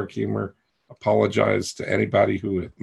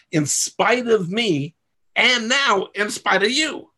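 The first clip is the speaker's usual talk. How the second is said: acted inner voice; 11 semitones higher, slower than usual. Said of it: "That's you inside".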